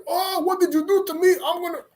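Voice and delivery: high squeaky whine of a cursed man; cursed man's complaint. Acted. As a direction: whine up high like a wronged fool. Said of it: "the man got cursed".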